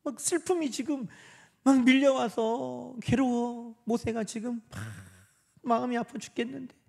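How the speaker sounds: high, softened voice of sorrow; imitated grief. Preacher voices Moses grieving his sister, imitated anguish.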